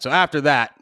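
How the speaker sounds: ordinary talk, nothing marked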